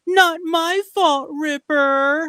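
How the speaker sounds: high, slow, nasal-free whine stretched out; whiny punk girl. Retold or imitated. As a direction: drawl it like a dubbed punk girl. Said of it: "the way she says it".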